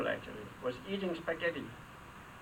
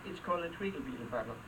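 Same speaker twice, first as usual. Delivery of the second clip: fast; 3 semitones lower, 5 dB quieter, faster than usual. Pretend. Tongue twister rattled off.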